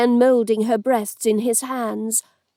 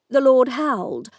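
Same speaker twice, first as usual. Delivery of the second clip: usual speaking voice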